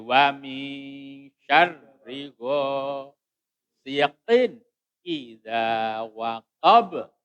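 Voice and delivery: "ghasiqin" ends on a clear, unblended n before "idza"; measured chant, "ghasiqin idza" clearly separated; clear izhar recitation. Shown as a demonstration. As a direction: recite slowly, sounding the n of "ghasiqin" plainly. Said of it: "Jadi dibaca jelas, dibaca terang".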